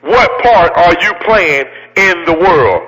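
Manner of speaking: slowed right down, each word weighed; slow emphatic repeat. Shown as a demonstration. Preacher repeating his question to the crowd, slow and deliberate. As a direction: drive the question home slowly. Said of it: "Let me say it again".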